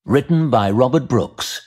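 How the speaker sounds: ordinary delivery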